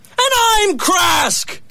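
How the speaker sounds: high, loud, slow declaration; man shouting his true identity at his friend. Acted. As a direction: proclaim who you are. Shouted claim.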